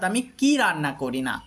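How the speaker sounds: কি hit hard, falling to the end; heavy emphasis on কি, the rest trailing down; stress on কি. Shown as a demonstration. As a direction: punch কি as a "what" question. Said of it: "যখন আমরা কি শব্দের উপর জোর দেব".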